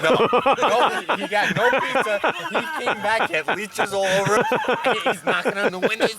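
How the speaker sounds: high, laughing retelling; can barely get it out for laughing; cracking up while telling a friend the ending; laughing through it. Real, not acted.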